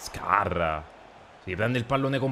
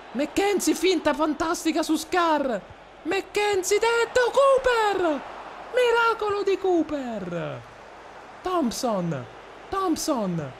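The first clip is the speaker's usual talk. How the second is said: much higher, squeaky play-by-play; Mickey Mouse voice; 13 semitones higher than usual. Acted. Streamer commentating his game in a squeaky Mickey Mouse voice. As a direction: call the match in a cartoon mouse squeak. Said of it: "voce alla Topolino".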